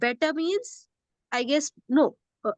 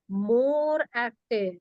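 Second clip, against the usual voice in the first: "more active" stretched slowly, MORE weighted; slow stress on MORE; much slower than usual. Shown as a demonstration. Teacher modeling the comparative very slowly, stressing MORE. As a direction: draw it out, hitting MORE. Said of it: "So I'm going to say".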